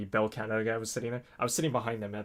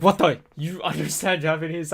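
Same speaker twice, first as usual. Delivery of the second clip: shocked; 6 semitones higher, 6 dB louder than usual. Retold or imitated. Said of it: "They're like".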